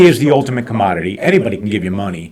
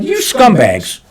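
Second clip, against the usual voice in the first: much higher, mock indignant; retold insult; 11 semitones higher, much slower than usual. Owner retelling his reaction to the bank's higher rate, mock disgust. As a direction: spit it out as a raised-pitch jab. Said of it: "And I'm like".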